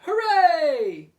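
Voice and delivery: very loud, high cheer; shout. Acted. Workmen celebrating the cables, very loud cheer. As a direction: cheer at full voice. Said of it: "the other men shouted".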